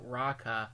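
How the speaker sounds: raka sounded out slowly, syllable by syllable; raka, each sound spelled out. Shown as a demonstration. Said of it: "they sound it out".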